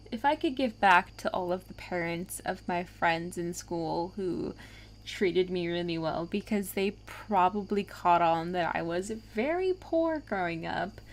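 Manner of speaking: usual speaking voice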